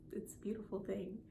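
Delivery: near tears, almost whispered; choked whisper. Real, not acted. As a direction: breathe it out, barely holding it together. Emotional, holding back tears.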